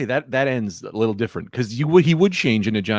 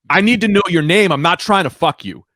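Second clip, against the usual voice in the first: raised, heated demand; getting mad; 6 semitones higher, 4 dB louder than usual. Retold or imitated. At the eye doctor, demanding her name, heated. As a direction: press hard for her name, loud and fed up. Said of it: "I immediately got mad".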